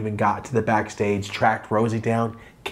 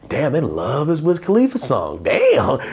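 surprised; 5 semitones higher than usual. Retold or imitated. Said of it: "I'm like".

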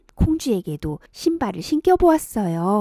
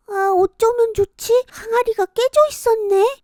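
put-on high, fretful girl's voice; worried girl; 12 semitones higher than usual. Kongjwi finding the broken jar, high worried voice. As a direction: fret like a young girl.

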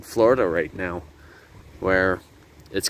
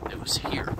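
whispering; 5 dB quieter than usual. Real, not acted. Awed whisper about Calusa capital.

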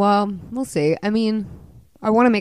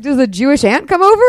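high, loud retold disbelief; incredulous; 10 semitones higher, 10 dB louder than usual. Playwright retelling her disbelief at being cast, incredulous. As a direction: throw out an incredulous question, much louder.

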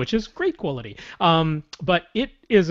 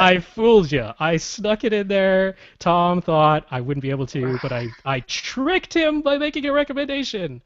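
louder, higher teasing brag; cheeky victory gloat; 5 semitones higher, 4 dB louder than usual. Pretend. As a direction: rub it in playfully. Gloating over tricking cohost.

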